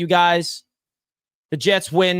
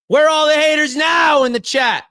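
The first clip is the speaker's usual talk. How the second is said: taunting shout; 6 semitones higher than usual. Real, not acted. Taunting the doubters.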